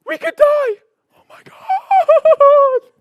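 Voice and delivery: high, loud, drawn-out mock panic. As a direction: play the frightened mind, hammed-up and slowed. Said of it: "what's happening in here is going".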